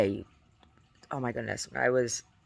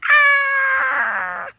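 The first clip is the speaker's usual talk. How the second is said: sudden high shrieking "Ah!"; kabuki scream; 14 dB louder than usual. Retold or imitated. Imitating the kabuki guy, a high piercing scream. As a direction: let out a piercing theatrical cry. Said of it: "Sounds like the guy screaming".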